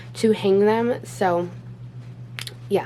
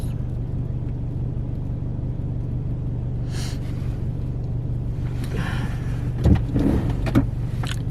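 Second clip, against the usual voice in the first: long weary sigh. Real, not acted. After argument with husband.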